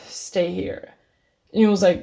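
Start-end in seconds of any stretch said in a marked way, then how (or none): 0.1-0.9 sighed, breathy and much softer; resigned sigh. Retold or imitated. Letting the drunk friend stay over, defeated sigh. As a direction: give up and let it out on a sigh.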